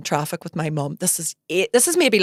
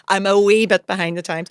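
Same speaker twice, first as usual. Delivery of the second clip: ordinary talk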